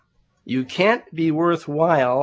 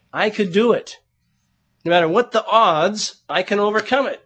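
louder, higher self-confident declaration; self-reliant former self; 6 semitones higher, 5 dB louder than usual. Retold or imitated. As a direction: voice your old can-do self, raised and emphatic. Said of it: "I used to live a life where it was me".

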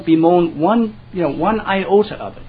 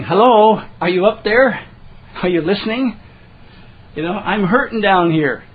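emotional SOS, pitched up and raised; crying out to God; 3 semitones higher, 5 dB louder than usual. Acted. Psalmist calling up to God in distress, crying out. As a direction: call up for help, checking someone is listening. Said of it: "It's an emotional S O S".

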